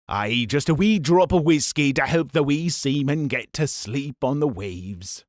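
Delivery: put-on Scottish sailor's accent, slowing at the end. Scottish first mate on giving a baby whiskey, Scottish accent. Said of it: "he was a Scottish lad".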